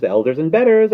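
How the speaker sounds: high, loud conceited bird; loud, climbing bird boast; rising scream. A narrator's reading. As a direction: build towards a scream. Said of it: "he screamed".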